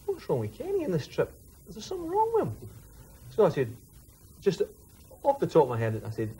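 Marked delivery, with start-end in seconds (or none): 0.1-2.6 imitating Nico. Nico asks about Kenny.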